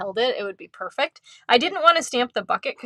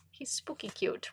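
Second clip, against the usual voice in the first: hushed, breathless whisper on "He's spooky cute"; 4 semitones higher, 12 dB quieter than usual. Shown as a demonstration. To viewers about the card, a playful whisper.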